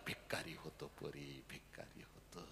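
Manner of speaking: hushed breathless whisper. Acted. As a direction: drop to a whisper. Emotional hush after loud climax.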